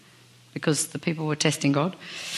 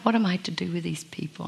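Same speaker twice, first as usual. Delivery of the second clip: soft, hushed, tired imitation of Moses; quiet weary question; 7 dB quieter than usual. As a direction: mutter it quietly like a worn-out leader. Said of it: "Do you think he was like".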